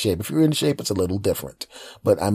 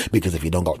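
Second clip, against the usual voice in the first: ordinary talk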